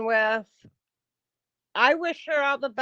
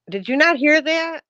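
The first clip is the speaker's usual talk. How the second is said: louder and higher, stress on not; exasperated; 5 semitones higher, 6 dB louder than usual. Real, not acted. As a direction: demand it in disbelief. Exasperated at commenters.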